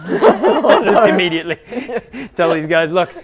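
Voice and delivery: laughing through a mock confession. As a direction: giggle it out loud and high. GM mocks character confessing, laughing.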